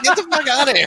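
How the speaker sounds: high, exasperated movie-mom voice; mock exasperated mom. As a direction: play an alarmed mom shooing everyone away.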